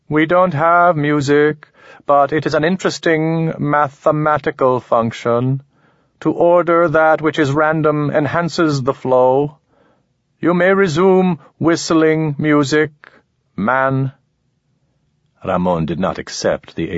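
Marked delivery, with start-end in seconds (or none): 0.1-1.7 high, slow, detached alien; even and unmoved, like something inhuman. Acted. Alien explains its kind. 2.1-14.1 cool otherworldly being, measured and precise; alien voice. Acted. Alien speaks to Ramon.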